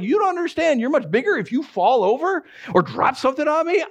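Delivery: hint of a defensive cat, pitched high; protesting cat. Cat to the friendly human, high mock protest. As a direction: explain the danger in a high, protesting voice. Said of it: "And it's like".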